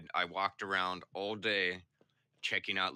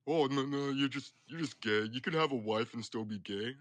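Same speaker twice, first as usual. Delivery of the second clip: mocking insistence; 6 semitones higher, 5 dB louder than usual.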